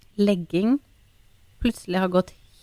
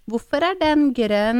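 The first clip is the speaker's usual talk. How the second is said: put-on slow higher childish question; random child question; 5 semitones higher, slower than usual. Mother imitating daughter's stalling question. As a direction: ask out of nowhere like a stalling kid. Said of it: "kan du plutselig si".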